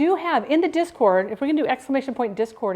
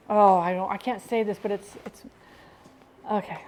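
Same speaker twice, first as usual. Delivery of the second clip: normal talking voice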